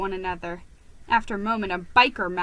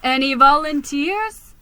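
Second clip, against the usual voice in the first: calling out; 5 semitones higher, 8 dB louder than usual. Acted. Calling out to crowd.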